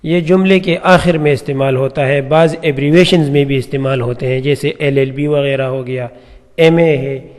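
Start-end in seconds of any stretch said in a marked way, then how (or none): none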